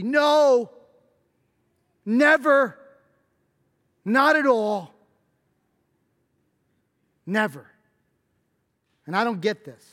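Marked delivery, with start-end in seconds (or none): 0.0-7.7 loud, high insistent never; emphatic no. Real, not acted. Preacher flatly refuses pity for Julie, loud and firm. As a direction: cut the idea off hard.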